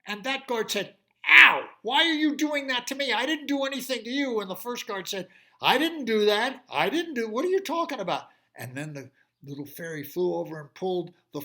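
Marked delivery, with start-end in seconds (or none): 1.3-4.4 high, loud, fast complaining guard; hurt guard. Acted. 5.6-8.3 loud, high protest, getting fast; indignant guard. Acted. Guard denies loudly.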